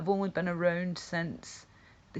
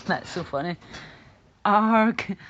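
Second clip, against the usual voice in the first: normal talking voice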